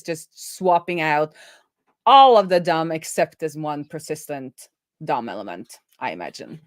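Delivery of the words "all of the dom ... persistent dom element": usual speaking voice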